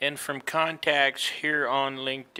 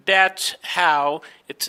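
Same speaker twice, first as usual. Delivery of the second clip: normal talking voice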